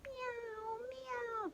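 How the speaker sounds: very high kitten meow; cat meow. Shown as a demonstration. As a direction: mew like a tiny kitten. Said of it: "what's a cat say?".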